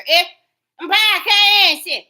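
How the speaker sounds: high, loud mock voice of her; loud mocking imitation. Mocking the woman's claim of innocence, loud high parody. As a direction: parrot her like a taunt.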